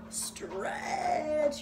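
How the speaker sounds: very slow, elongated first word; lingering, stretched-out instruction; drawn-out stretch. Shown as a demonstration. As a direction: pull the vowel out like a long stretch. Acting out a long stretch.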